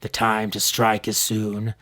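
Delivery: slow, low, quiet threat; chilling villain. Acted. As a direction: let the threat hang, slow and cold. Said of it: "in his deep, quiet, yet chilling voice".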